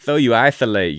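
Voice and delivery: loud ladies' man take, sung back. Retold or imitated. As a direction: mimic the smooth singer. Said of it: "I just sounded like the ladies' man".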